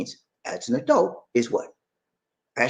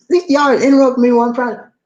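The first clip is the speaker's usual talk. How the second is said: louder, higher exasperated complaint; fed up with being interrupted; annoyed; 10 semitones higher, 6 dB louder than usual. Real, not acted.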